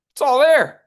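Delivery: high, loud declaration; ringing It's all there; emphatic exclaim. Shown as a demonstration. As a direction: belt it out like a revelation. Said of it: "coach repeats emphatically".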